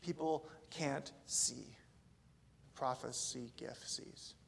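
slow hushed whisper; hushed emphasis. Real, not acted. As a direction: lean in and whisper it slowly.

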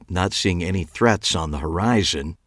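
usual speaking voice

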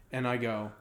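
usual speaking voice

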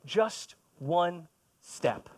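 very slow, each word set apart; slow emphasis. Shown as a demonstration. Preacher giving his congregation the key advice, very slow emphasis. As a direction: lay each word down slowly. Key sermon point, slowed for weight.

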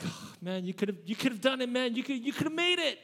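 lightly voiced letdown, rising high; mildly disappointed coach; a hint of disappointment. Shown as a demonstration. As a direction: let down but not angry. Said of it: "not anger, but like".

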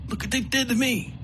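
wounded man nursing a grudge; bitter wounded outlaw. Acted. Coakley voiced in story.

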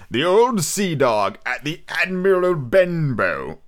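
slow, drawn-out announcement of the chapter title; grand title reading. Acted. Reader announcing the chapter title, slow and grand. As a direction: read the title like a grand opening.

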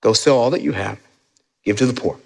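scripture reading. A narrator's reading. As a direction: read it gently and low, with space between phrases. Reading Jesus' words.

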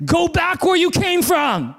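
high, slow mocking voice of a heckler; mocking a stranger's jeer. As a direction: sneer it out slowly. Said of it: "they say".

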